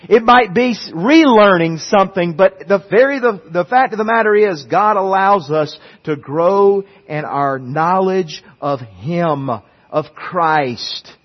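loud, rising preacher's build; preaching. Acted. Pastor to congregation, loud preaching build. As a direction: drive the point home to the pews.

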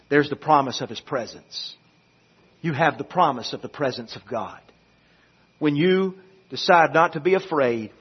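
soft, low, solemn assurance; hushed preaching. Real, not acted. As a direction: drop low and soft to reassure the congregation. Hushed, reflective moment in sermon.

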